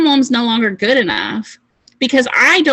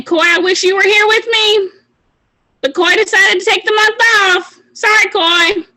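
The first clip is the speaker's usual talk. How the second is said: higher mock-sorry teammate voice, called out; 9 semitones higher than usual. Teammate missing the trip, mock regret. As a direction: play a teammate left behind, teasing.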